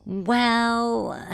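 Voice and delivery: long, high, loud drawn-out well; hesitant stalling. Acted. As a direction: stretch it out, stalling before bad news. Demon reluctant to explain the sacrifice.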